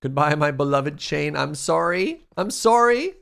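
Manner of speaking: theatrical grief, climbing in pitch; mock anguished farewell. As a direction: build to a pretend wailing sorry. Mock farewell to the losing option.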